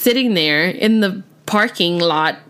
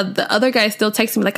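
ordinary talk

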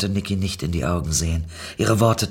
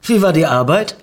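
forced cheer; 7 semitones higher, 8 dB louder than usual. Acted.

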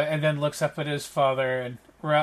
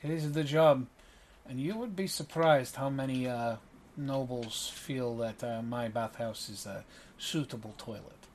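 courteous Ravi; 2 semitones lower, 4 dB quieter than usual. Acted. Ravi thanks a generous patron, quiet and courteous. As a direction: thank them formally and quietly. Game master plays Ravi.

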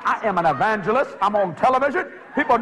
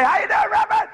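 high neighbor voice; faster than usual. Retold or imitated.

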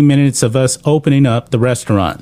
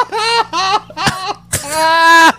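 high burst of laughter. Real, not acted. Loud laugh.

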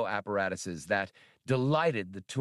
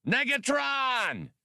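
objecting alien trader. Acted. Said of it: "Fadoop objected".

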